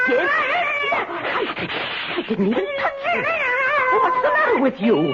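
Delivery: high, startled cries at an intruding cat; alarmed shooing. Acted. Old woman startled by a strange cat in her house, high alarmed cries. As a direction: shoo it out, voice climbing in panic. Cat reacts, she recoils.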